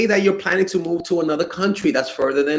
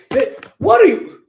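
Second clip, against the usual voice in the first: high, loud stunned question; high shocked outburst; shocked disbelief; 12 semitones higher, 6 dB louder than usual. Real, not acted. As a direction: react like you can't believe it. Shocked reaction to message.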